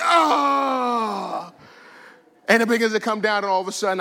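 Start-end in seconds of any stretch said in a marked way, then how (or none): 0.0-1.5 long, high mock wail; loud, drawn-out comic "ah"; conviction hits. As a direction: cry out as if struck by conviction. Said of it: "the spirit of conviction comes on you".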